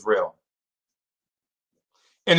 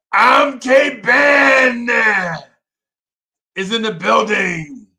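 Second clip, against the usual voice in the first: much higher, louder shouted intro; hype announcer shout; 9 semitones higher, 4 dB louder, slower than usual. Real, not acted. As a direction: shout the name out like a ring announcer. Announcing viewers in chat.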